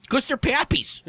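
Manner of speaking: high, hammed-up old-timer voice; old prospector. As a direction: cackle it like a grizzled miner. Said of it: "old prospector voice talking about onions".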